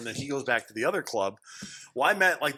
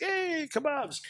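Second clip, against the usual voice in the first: high, bright-eyed hey to the kebab shop; mock excited greeting; 13 semitones higher, 5 dB quieter, much slower than usual. A drunk guy at 2 a.m. hailing the kebab stand, mock excited. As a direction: greet the food like an old friend, pitched way up.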